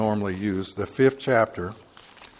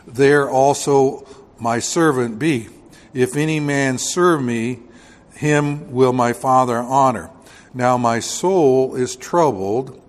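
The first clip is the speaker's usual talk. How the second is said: loud, slow pulpit reading of scripture; preaching scripture reading; 6 dB louder, slower than usual. Acted. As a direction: declaim the verses to the congregation. Preacher reads scripture aloud.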